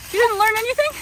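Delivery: high, loud mock shock; surprised. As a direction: gasp it out in playful disbelief. Mock shock at child's answer.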